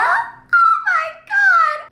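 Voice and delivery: very high, long, drawn-out shriek of "oh my God"; shocked squeal. Real, not acted. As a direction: stretch it out in a high-pitched shocked wail. Shock at a character reveal.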